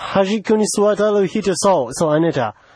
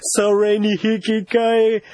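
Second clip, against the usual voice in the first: ordinary talk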